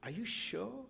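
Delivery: high, disbelieving are-you-sure; incredulous delight. Retold or imitated. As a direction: ask twice as if it can't be true.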